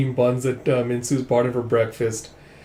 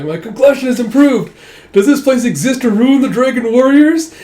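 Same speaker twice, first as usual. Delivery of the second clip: very high, loud gushing falsetto; mock pampered gush; 11 semitones higher, 9 dB louder than usual. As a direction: squeal it again, delighted with yourself. Repeating the character line.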